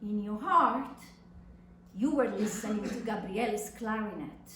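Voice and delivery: plain reading voice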